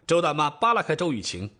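plain reading voice

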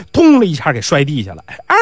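plain reading voice

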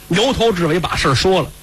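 usual speaking voice

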